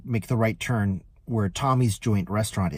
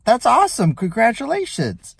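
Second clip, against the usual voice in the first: high, bright-eyed congratulations, louder; excited congratulations; 13 semitones higher, 6 dB louder than usual. Retold or imitated. Driver congratulating the pregnant passenger, high and excited. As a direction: gush over the good news. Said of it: "I say".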